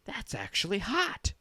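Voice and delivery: high-pitched voice of an amazed grandma. Acted. Grandma seeing the microwave steam, high-voiced amazement. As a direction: play Grandma marvelling in a high voice. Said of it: "Grandma goes".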